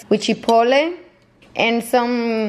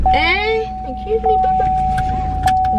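high squeaky voice; slower than usual. Acted. Voicing the dog in the car.

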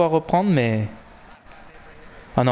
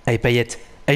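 normal talking voice

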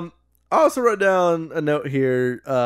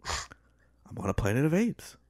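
slow whispered Boston upswing; 8 dB quieter than usual. Shown as a demonstration. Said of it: "upswing in your inflection ... Boston accent".

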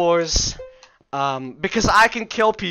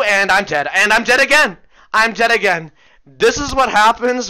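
exasperated shout; 5 semitones higher, 8 dB louder than usual. Real, not acted. Dying repeatedly.

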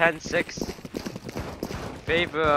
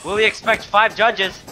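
excited higher-pitched call; esports caster; 7 semitones higher, 5 dB louder than usual. Acted. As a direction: build the hype on the pick. Live play-by-play call.

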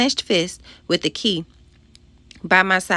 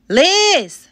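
loud, high scream for help; piercing cry of alarm; panicked scream; 12 semitones higher, 7 dB louder than usual. A narrator's reading. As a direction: scream the friend's name from inside the trunk. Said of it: "I screamed".

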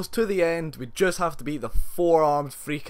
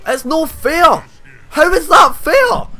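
very high, loud outraged scream, slowing down; furious screaming; 13 semitones higher, 11 dB louder, much slower than usual. Real, not acted. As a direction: lose it completely at the game. Losing to Goro, raging.

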